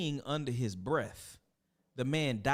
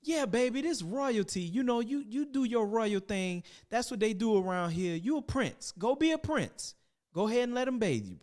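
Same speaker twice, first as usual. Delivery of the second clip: coaxing mama; 9 semitones higher than usual. Acted. Mama reassuring her son.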